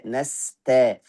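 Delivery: ordinary delivery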